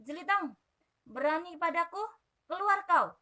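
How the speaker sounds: furious challenge shouted at the door; shouting. Acted. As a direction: yell him out of his house. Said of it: "teriak Jerabang".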